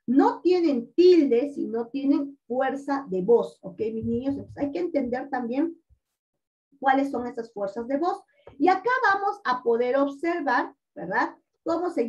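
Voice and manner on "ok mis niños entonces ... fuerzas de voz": ordinary delivery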